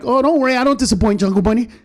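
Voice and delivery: high, cocky stage comeback; high mocking retort to a heckler. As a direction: fire it back at the heckler, smug.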